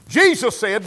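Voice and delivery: usual speaking voice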